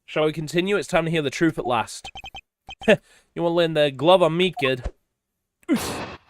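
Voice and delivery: lightly acted character lines, a hint of each voice; voiced game dialogue reading. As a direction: read the scene as the cast, lightly voiced.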